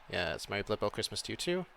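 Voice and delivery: ordinary talk, nothing marked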